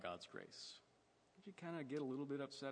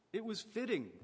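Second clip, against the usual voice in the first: fitting hit much higher and louder; joyful insistence on fitting; emphatic fitting; 11 semitones higher, 5 dB louder than usual. Acted. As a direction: lean hard on fitting. Preacher stresses father's reply.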